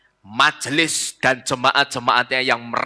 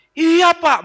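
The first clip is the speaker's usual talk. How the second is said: high, louder eager agreement; eager congregant; 14 semitones higher, 6 dB louder than usual. Acted. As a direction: chime in high and insistent. Jemaat menyahut bersemangat. (jemaat menyahut dengan suara tinggi)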